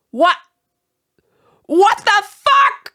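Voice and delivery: ordinary delivery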